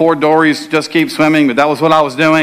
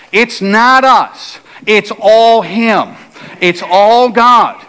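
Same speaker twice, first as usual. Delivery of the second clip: preaching; 5 semitones higher, much slower than usual. Acted. Pastor telling the church it is all God, preaching. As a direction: drive home that it is all God.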